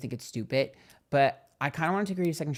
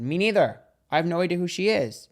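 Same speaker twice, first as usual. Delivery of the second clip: a retold reply, higher and louder; mock-indignant, higher and punchier; the retold answer to the booth girl, raised and pitched up; emphatic retold reply; 6 semitones higher, 7 dB louder than usual.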